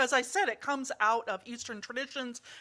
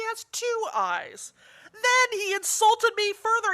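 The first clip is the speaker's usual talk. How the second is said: building indignation; 4 semitones higher, 6 dB louder than usual. Acted. One-eyed monk's angry account.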